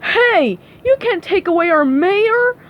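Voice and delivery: high, loud protest; crying out. Acted. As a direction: call out in alarm to stop them. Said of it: "a man cried".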